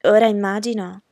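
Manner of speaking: ordinary delivery, nothing marked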